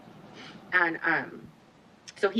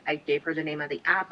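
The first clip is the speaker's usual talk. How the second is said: ordinary delivery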